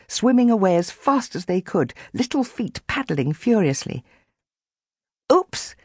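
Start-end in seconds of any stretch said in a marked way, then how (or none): 5.3-5.7 put-on grinning boy. A narrator's reading. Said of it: "the boy chasing the dog grinned".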